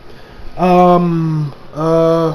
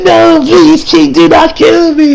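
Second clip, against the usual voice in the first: high, slow groveling plea; mock begging; 9 semitones higher, 5 dB louder, slower than usual. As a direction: whine for mercy like a cowering monster. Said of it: "on his hands and knees begging".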